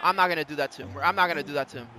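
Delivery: quiet, low muttering; hushed, low-key remark; low aside. Real, not acted. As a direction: say it softly to yourself. Sparing opponent the replay.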